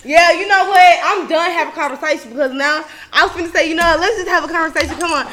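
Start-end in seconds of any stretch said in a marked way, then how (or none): none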